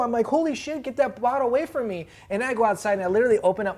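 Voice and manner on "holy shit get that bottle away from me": high-pitched retold alarm; mock panic. Hot sauce victim telling coworkers off, in high mock panic. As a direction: act out frantically warding something off.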